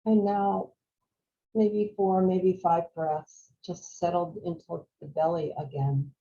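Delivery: calm, unhurried meditation voice with long pauses; guided meditation. Real, not acted. Teacher guiding a group to follow the breath, calm meditation voice. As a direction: guide them gently through each breath, leaving space.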